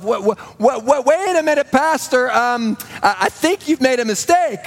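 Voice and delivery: a seminarian sure the preacher erred; retold surprise. To himself about the guest pastor, flustered surprise. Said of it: "I remember thinking to myself".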